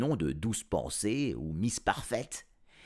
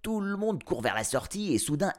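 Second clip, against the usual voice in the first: plain reading voice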